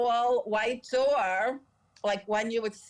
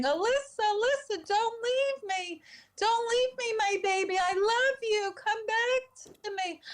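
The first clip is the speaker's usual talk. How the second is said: high-pitched wailing mother, acted out; imitated screaming, crying; 13 semitones higher than usual. Mother at her child's casket, high crying wail acted out. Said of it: "she kept screaming, crying".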